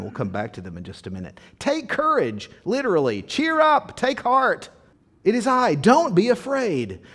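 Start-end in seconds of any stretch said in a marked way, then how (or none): 1.6-3.2 high-pitched, emphatic exhortations; encouraging call. Acted. Jesus to frightened disciples, high emphatic encouragement. 3.2-7.0 high, loud, emphatic exhortations; encouraging call. Acted. Jesus to frightened disciples, loud high encouragement.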